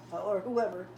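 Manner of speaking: ordinary talk, nothing marked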